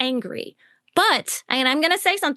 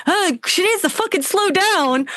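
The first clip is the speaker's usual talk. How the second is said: higher, exasperated mock complaint; mock critical commenter; 7 semitones higher, 3 dB louder than usual. Coach imitates a YouTube comment, mock exasperated. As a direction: imitate a fed-up online critic. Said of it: "YouTube comments or something".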